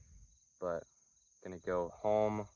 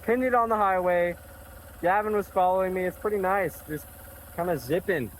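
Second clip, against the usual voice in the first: loud and high, over engine noise; shouting over wind; 8 semitones higher, 6 dB louder than usual. Real, not acted. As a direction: raise your voice over the wind.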